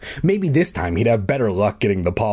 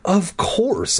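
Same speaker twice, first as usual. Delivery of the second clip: higher, a sudden realisation; excited cry; 8 semitones higher than usual. A narrator's reading. Bee-boy realising what happened, crying out. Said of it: "I cried".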